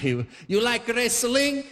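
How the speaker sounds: put-on slow, high Italian-accented question; Italian father accent. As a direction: challenge them like an Italian father, drawn out. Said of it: "said it like my Italian father".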